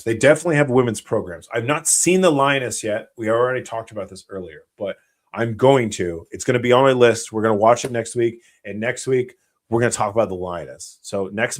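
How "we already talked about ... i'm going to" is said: normal talking voice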